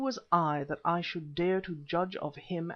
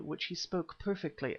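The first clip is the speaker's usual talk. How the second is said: ordinary delivery, nothing marked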